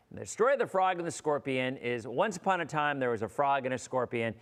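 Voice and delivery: ordinary delivery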